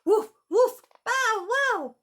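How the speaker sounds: loud high excited barking; dog barks. Acted. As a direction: bark like excited puppies. Dogs barking in story.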